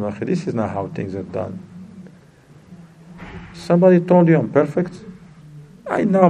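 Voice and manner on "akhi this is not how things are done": quiet advice to a brother. Retold or imitated. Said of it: "Then you tell them".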